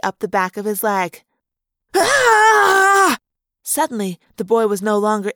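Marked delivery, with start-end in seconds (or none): 1.9-3.2 high, loud startled shriek; shrill scream. Acted. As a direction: shriek in sudden panic. Rat climbing up boy's leg.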